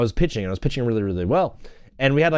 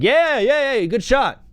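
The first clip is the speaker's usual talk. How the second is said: mock cheering; 7 semitones higher, slower than usual. Imitating players cheering at basketball.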